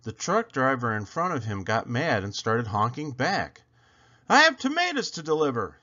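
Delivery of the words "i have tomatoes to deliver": fast, higher, louder shout. A narrator's reading. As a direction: bark it out in a hurry. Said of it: "he shouted".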